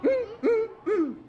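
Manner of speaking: high sassy mm mm mm; sassy mama bear hum. Shown as a demonstration. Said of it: "can you go for me".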